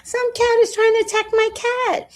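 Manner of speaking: very high, loud alarmed cry; the speaker sees a cat attacking, very high alarm; real alarm. Real, not acted. Cat fight starting live.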